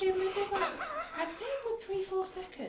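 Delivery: high voice through laughter; laughing. Real, not acted. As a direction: giggle through the words. Laughing about a horse sound.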